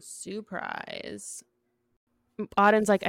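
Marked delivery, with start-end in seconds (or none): 0.0-1.4 soft, drawn-out mock surprise; sarcastic surprise. Real, not acted. Host unsurprised that dad won, hushed sarcasm. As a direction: let it out quietly, unimpressed.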